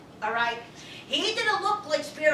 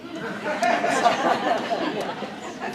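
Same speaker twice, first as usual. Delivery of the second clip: hearty laugh. Real, not acted. Laugh after joke.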